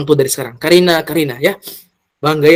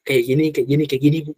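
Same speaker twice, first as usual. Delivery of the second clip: gently; 5 dB quieter, much faster than usual. Shown as a demonstration. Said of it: "Pelan-pelan".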